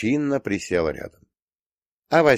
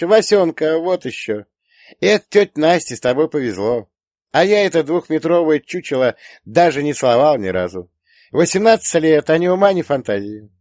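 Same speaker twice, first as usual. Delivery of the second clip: high, loud scornful daughter; scoffing; 9 semitones higher, 7 dB louder than usual. A narrator's reading. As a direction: dismiss him in a loud girlish voice. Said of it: "фыркнула дочь". (пренебрежительно, высоким голосом дочки)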